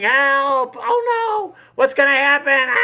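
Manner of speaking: high, loud mock panic; screaming for help. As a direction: scream like a terrified crowd, hammed up. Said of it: "They're screaming".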